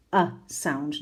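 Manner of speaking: "uh" said as a short, soft, relaxed schwa; schwa demo. Shown as a demonstration. Teacher models the schwa for her class, soft and relaxed. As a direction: model the "uh" as a lax, neutral vowel. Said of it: "which we know is called the schwa".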